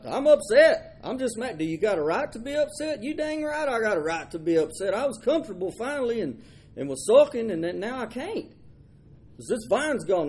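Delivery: a pouting prophet griping that he was wronged; sulking Jonah. Acted. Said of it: "he's brutally angry and mad".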